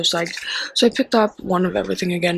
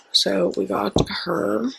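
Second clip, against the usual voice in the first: whisper. Shown as a demonstration. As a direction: breathe it out in a whisper. Haul item, whispered this time.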